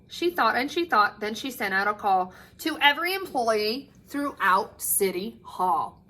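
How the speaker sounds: usual speaking voice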